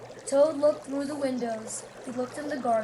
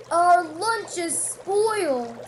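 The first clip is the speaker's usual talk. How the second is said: slow, raised lament; slow, dismayed cry over spoiled lunch; 5 semitones higher, 5 dB louder, slower than usual. A narrator's reading. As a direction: mourn the ruined lunch. Toad upset about lunch.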